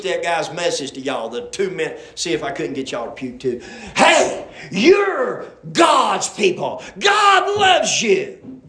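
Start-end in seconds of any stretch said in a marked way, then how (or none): 4.0-8.6 high, loud, drawn-out mock preacher cadence; mocking preacher impression. Preacher aping another preacher's message for his congregation, mocking cadence. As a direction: exaggerate a rival preacher's slow, soaring cadence. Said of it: "he didn't say it like that".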